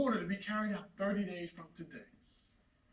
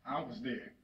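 low, soft, weighted statement; subdued accusation; 12 semitones lower, 12 dB quieter than usual. Acted. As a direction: drop right down and let it sit. Same accusation, now subdued.

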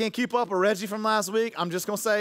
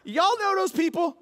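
much higher, slow, far-off voice; mock denial; 10 semitones higher, 3 dB louder, slower than usual. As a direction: pretend you don't know them. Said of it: "I'm like".

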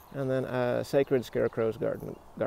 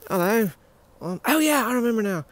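high, loud put-on British voice; British accent; 8 semitones higher, 6 dB louder than usual. Suddenly remembering, in a high put-on British accent. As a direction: play it excitable in mock British. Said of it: "I don't know why I'm speaking British".

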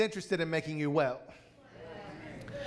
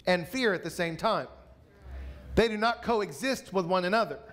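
preaching at full force; 3 semitones higher than usual. Acted. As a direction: drive it home to the congregation, building.

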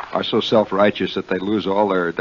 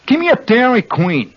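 high, blunt Jersey "Dairy Queen"; New Jersey accent; 13 semitones higher, 4 dB louder than usual. Shown as a demonstration. Host demonstrates ordering like a native, high Jersey accent. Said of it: "that's the correct pronunciation".

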